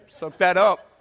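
mock dismissiveness. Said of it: "you've done the face".

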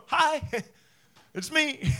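mock-sweet high "Hi, it's me" with a laugh; high lilting voice. Jesus greeting hopeless listeners, high and lilting. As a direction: chirp it up high and light, half giggling. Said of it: "a higher kind of lilting voices".

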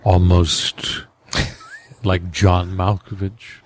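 measured stagey drawl; John Malkovich voice. Shown as a demonstration. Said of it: "He talks like this".

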